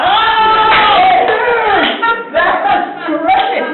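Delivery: very high, loud, delighted outburst; amazed shouting. Real, not acted. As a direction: shriek with excitement at the performance. Amazed by the accent demo.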